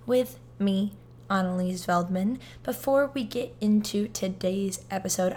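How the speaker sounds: upbeat, loud podcast host greeting; podcast host intro. Acted. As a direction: welcome the listeners like a show host.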